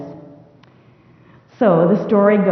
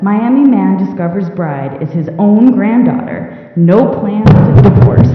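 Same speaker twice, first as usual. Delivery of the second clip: louder, carried-away headline reading; over-excited; 7 dB louder than usual. Real, not acted. Lecturer reading a favorite headline example to the audience, excited. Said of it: "I got so excited".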